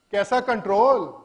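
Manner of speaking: loud, quick rhetorical question; incredulous challenge. Real, not acted. Speaker challenging partners who claim account control, incredulous. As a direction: throw the question out loudly in disbelief.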